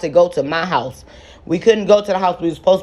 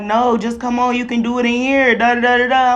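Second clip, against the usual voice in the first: pitched-up voice of the pushy friend, coaxing; 7 semitones higher than usual. Retold or imitated. Friend pressing her to come into the boy's house, higher and coaxing.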